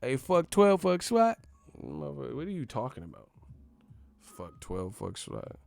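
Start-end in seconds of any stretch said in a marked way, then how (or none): 0.2-1.4 high, loud mock bravado; trying too hard. Mocking a store worker acting hard, high loud imitation. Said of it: "just be trying too hard". 4.2-5.6 low, soft mocking repeat; unimpressed repeat. Repeating a wannabe's line in disbelief, low and quiet.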